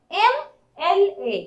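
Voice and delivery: एमएलए said slowly so the opening ए vowel rings clear; drawn-out MLA. Shown as a demonstration. Teacher to Hindi class, slowly sounding out एमएलए. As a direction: stretch एमएलए to show it starts with ए. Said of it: "यहाँ एम बोलते हैं तो ए का sound पहले आता है".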